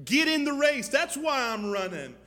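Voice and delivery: loud, high call to action; preaching shout. Real, not acted. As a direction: thunder it at the congregation.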